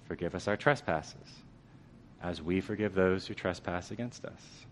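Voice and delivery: slow, soft prayerful recitation; the congregation's daily prayer, slow and soft. Acted. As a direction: pray it gently, slowly. Praying the Lord's prayer.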